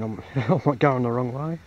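normal talking voice

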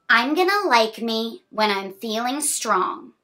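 bright, sing-song picture-book reading; reading to children. A narrator's reading. Reading a picture book to a child, sing-song. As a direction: read the rhyme cheerfully to a small child.

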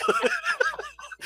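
high wheezy laugh; helpless laughing fit; real laughter, pitched very high; burst of laughter. Real, not acted.

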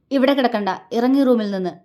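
loud, angry order. A narrator's reading. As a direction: order her out forcefully. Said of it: "അവൻ്റെ ശബ്ദം ഉയർന്നു". (ശബ്ദമുയർത്തി ദേഷ്യത്തോടെ)

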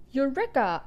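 the father's mock-grand eureka; triumphant cry. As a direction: cry it out like a proud inventor. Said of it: "I cried".

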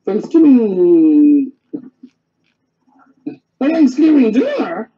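drawn-out, wondering exclamation rising in pitch; astonished exclamation. A narrator's reading. Everyone exclaims at once at the invitation, slow astonishment. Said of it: "exclaimed everyone".

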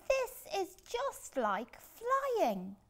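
slow delighted wonder. Acted. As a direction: marvel slowly. Wonder under the sea.

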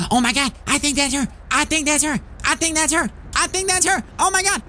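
building giddy disbelief; excited. Acted. Excited recognition of mother.

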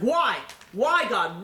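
high, loud anguished cry; retold screaming at God. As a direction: hurl the question up at God. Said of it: "he started screaming at God".

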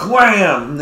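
loud wham; mock slap sound. Describing the slap from the ground up, a loud wham. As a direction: hit the word like a slap.

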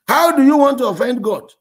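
high, loud demanding question; loud challenge. Retold or imitated. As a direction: demand an answer. Pastor confronting her, retold.

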